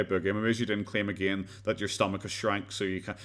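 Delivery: ordinary talk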